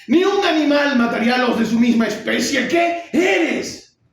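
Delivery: furious high-pitched shouted monologue; enraged, disbelieving outburst at full voice; irate. Acted. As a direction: rage at your father, loud and high, drawing out the key words. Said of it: "él responde iracundo".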